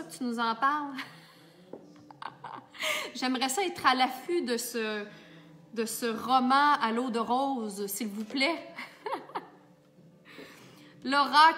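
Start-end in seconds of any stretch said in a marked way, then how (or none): 2.1-3.1 soft, very high giggle. Real, not acted. Host prying into Laura's love story, soft giggling. Teasing Laura about her romance. 8.7-9.4 quiet, high giggle; short soft laugh, pitched up. Real, not acted. Host amused at asking for romance news, quiet giggling. Amused by her own request.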